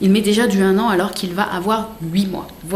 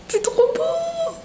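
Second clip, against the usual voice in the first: very high, slow cooing squeal; melting squeal; 4 dB quieter, much slower than usual. Real, not acted. Vlogger unwraps baby clothes, melting in a squeal. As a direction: draw it out in a tiny high voice.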